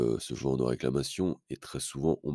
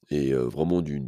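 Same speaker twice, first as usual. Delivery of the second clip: ordinary delivery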